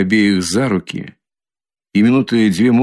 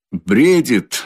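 higher, mildly exclaimed; tipsy cry; 4 semitones higher than usual. A narrator's reading. Drunken Razumikhin dismissing his friend's words, crying out. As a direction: toss it out as an understated drunken cry. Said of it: "закричал хмельной Разумихин".

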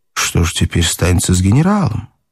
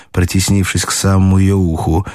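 usual speaking voice

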